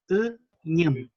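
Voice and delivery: "uñem" begun with an unrounded ü, lips spread. Shown as a demonstration. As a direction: open "uñem" with the throaty ü. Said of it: "poner la boca como si dijeran una I".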